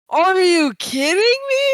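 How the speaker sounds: high, loud incredulous cry; can't believe what you just heard; shocked, voice jumping up and loud; stunned disbelief. Retold or imitated. Learning the prank truth.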